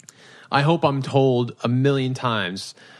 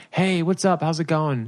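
higher-pitched, mock-casual hello; chirpy guest greeting; 7 semitones higher than usual. Wedding guest coming up to the couple, chirpy greeting. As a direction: butt in like a chatty guest.

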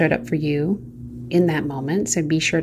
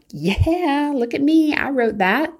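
smug, pleased with yourself; proud self-praise; 9 semitones higher, slower than usual. Retold or imitated. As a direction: read it like you're proud of your own writing. Imitating proud self-talk.

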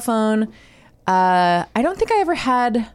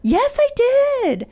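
high sing-song mom reply. Retold or imitated. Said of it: "I always have to say".

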